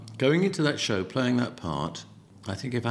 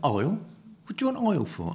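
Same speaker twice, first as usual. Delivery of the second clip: mum's disbelieving question, jumping much higher; incredulous mum; 7 semitones higher, faster than usual. Retold or imitated. Mum doubting her son's request for oil, incredulous. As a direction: scoff at the very idea of oil. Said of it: "She went".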